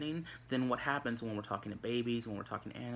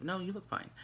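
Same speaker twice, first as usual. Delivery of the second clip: higher, quick plain reassurance; sincere, truthful; 7 semitones higher, 4 dB quieter than usual. Shown as a demonstration. Telling Miss Gamble the truth, sincere reassurance. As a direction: say it lightly and honestly. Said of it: "me telling the truth".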